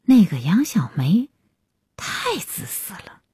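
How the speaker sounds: bitter grumbling about someone; resentful. A narrator's reading. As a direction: complain with lingering resentment. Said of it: "海云犹自恨恨".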